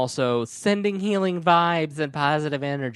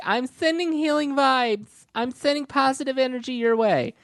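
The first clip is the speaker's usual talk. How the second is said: mocking airy voice; 10 semitones higher than usual. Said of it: "I'm saying that if you say".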